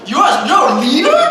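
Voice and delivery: high, shouted incredulous question; retold stunned yell; shocked disbelief. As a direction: blurt it out in loud astonishment. Retells his shock at the reveal.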